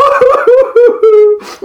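loud, very high mock sobbing; exaggerated boo-hoo; weeping wail. A narrator's reading. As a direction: bawl like a melodramatic tree. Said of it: "weeping willow".

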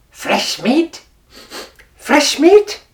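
rising, eager sniffing voice; a hungry jackal catching the scent. Acted. Jackal sniffing out the tiger's den, eager.

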